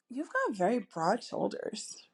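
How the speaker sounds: slow, soft flirty compliment; admiring, drawn-out come-on. Shown as a demonstration. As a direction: say it slowly and softly, as a flirt. Demo of flirty sample line.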